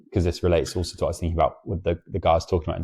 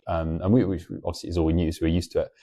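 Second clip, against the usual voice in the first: ordinary talk, nothing marked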